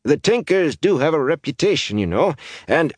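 put-on high, scratchy old councilman; wheedling scratchy voice, cut off. A narrator's reading. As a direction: raise doubts in a scratchy voice. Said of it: "he said slowly in that scratchy voice".

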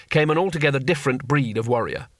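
usual speaking voice

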